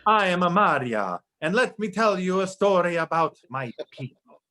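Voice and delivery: put-on heavy Eastern European storyteller accent; hammed-up Hungarian storyteller voice; Magyar accent. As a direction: tell a grim folk tale in a thick Hungarian accent. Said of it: "you have to say Magyar".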